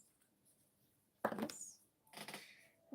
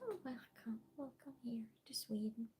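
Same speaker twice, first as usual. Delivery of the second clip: soft breathy whisper of welcome; 2 semitones lower, 16 dB quieter than usual. Real, not acted. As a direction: whisper the welcome close to the mic. Greeting a viewer in a whisper.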